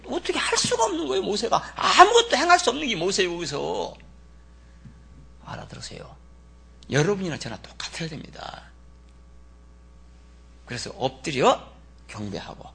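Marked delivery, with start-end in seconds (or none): none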